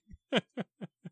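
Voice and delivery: awkward high giggle; nervous laugh. Real, not acted. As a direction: laugh awkwardly to fill silence. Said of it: "laugh awkwardly until you come back".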